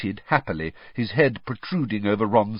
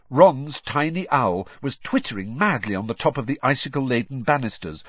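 ordinary delivery

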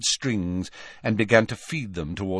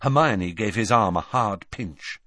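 ordinary delivery, nothing marked

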